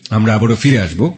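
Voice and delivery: bright on-air host voice going to a break; radio host link. Acted. Radio host tells listeners about the break, on-air host voice.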